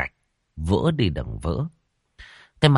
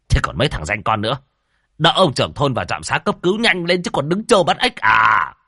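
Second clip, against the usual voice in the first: higher, louder furious rant; angry scolding; 6 semitones higher, 4 dB louder than usual. A narrator's reading. Shaman scolding gossiping villagers, loud and angry. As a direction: tear into the gossips. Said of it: "Nghe lão thống quát to".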